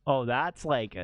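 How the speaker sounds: normal talking voice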